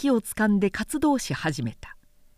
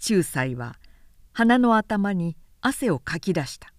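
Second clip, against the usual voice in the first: ordinary delivery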